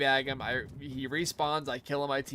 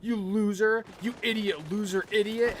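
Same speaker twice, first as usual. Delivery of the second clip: imitating an angry yell; 5 semitones higher than usual. Retelling the toxic teammate's rant, mock angry yell. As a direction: sneer the insults like the toxic player did. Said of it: "flipping out".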